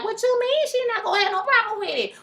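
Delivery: high, mock stunned question; incredulous. Vlogger imitating the woman hearing he is in an open relationship. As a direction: play her disbelief at the open relationship. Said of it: "she like".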